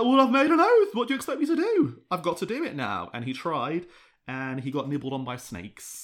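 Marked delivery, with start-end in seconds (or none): 0.0-3.1 high, loud indignant protest, fast at the end; exasperated Ragnar. Retold or imitated. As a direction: protest like a stubborn king. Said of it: "And he's like".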